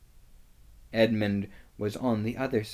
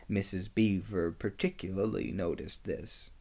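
usual speaking voice

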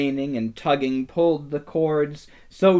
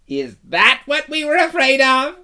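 high, loud scornful sneer; mocking question; 12 semitones higher, 7 dB louder than usual. A narrator's reading. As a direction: ridicule their own fear. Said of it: "said another".

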